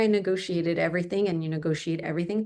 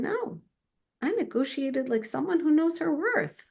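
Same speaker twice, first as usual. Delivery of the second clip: proud retort; 8 semitones higher than usual. Retold or imitated.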